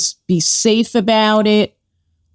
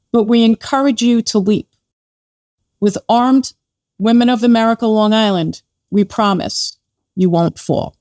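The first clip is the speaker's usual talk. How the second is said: slower, deliberate read. Shown as a demonstration. Rereading the group's statement slowly for the host, deliberate pace. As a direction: read the statement slowly and clearly, pausing between phrases. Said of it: "read that to me one more time, just a little bit slower".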